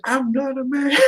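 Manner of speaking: high mock-offended protest; mad retold protest. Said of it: "kept getting so mad".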